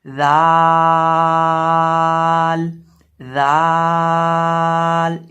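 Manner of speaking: sustained, slow जाल demo; dental fricative letter. Shown as a demonstration. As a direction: stretch जाल on one note, starting with tongue at the teeth. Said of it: "जाल पढ़ना है".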